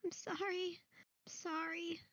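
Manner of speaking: high, tearful apologies; high tearful boy repeating sorry; crying child. Acted. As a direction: sob out sorry like a scared boy. GM voices crying child NPC.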